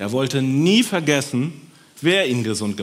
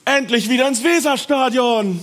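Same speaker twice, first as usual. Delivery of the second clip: high, loud celebrating cry; joyful shout; 12 semitones higher, 6 dB louder than usual. Acted. Healed man announces a feast for friends, loud and joyful. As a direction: announce a party with glee.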